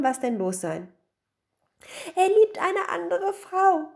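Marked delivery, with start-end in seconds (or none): 2.1-3.9 high, loud, slow lament; hammed-up weeping voice; tearful wailing. A narrator's reading. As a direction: wail it like a betrayed wife. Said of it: "weinte die Gattin".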